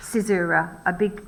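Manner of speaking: ordinary talk, nothing marked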